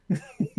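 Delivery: a bright burst of real laughter; short laugh. Real, not acted. Laughing while recalling his grandfather in the barn. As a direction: laugh at the memory.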